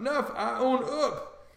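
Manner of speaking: high, slow choked gasp; half-strangled king gasps out to Duke, gasping. A narrator's reading. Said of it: "then he gasps out".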